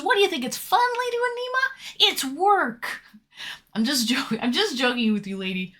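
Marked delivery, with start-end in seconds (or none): 0.0-3.0 voicing a chatter, higher pitch; mock chatter voice. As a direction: read chat in a lightly put-on higher voice. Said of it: "Fred Andy says".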